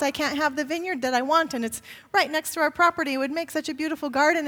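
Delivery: pouting whine. Acted. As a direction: whine it like a spoiled child. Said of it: "he pouts".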